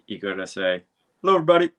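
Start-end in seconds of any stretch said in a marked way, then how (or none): 1.2-1.7 high, loud showman greeting; Vinyl Richie voice. Shown as a demonstration. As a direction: boom it out like a radio host. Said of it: "in your best Vinyl Richie voice".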